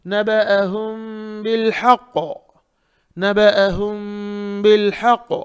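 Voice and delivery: नबअहुम बिल हक़ with long held m and throaty ha; model recitation. Shown as a demonstration. Teacher shows "like this", model recitation. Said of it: "हा सही निकालेंगे".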